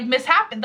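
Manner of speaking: "mishappen" said as mis-HAPPEN; misread misshapen. Shown as a demonstration. Owning up to a reading mistake, "mishappen" said as mis-happen. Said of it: "in my head, it was mishappen".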